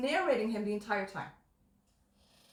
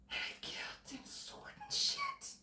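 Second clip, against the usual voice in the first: high, slow whisper, much softer; mock whisper; 13 dB quieter, slower than usual. Joking about the actor's accent role, whispering. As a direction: breathe it like a secret.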